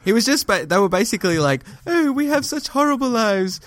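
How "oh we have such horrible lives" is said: high mock English accent; fake English accent. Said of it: "with their faux English accents".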